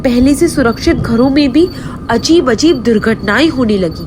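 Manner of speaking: usual speaking voice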